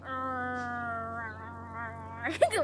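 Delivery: long, low imitated growl; angry dog growl. Haman fuming, growling like a dog. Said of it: "like an angry dog".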